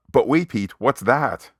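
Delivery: hammed-up sudden discovery; mock alarm. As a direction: set up the gag with staged alarm. Staged alarm setting up co-host's line.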